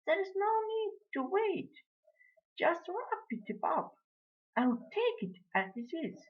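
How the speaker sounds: very high lady's voice; imperious lady customer. A narrator's reading. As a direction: dismiss the butcher's weighing in a high voice. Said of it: "the lady would say".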